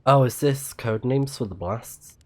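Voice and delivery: ordinary talk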